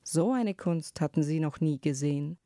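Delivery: ordinary delivery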